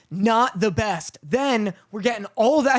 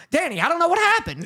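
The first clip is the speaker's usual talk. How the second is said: higher, fast imitation of wife; imitating wife; 8 semitones higher, faster than usual. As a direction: mimic her baffled fluster. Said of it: "She goes".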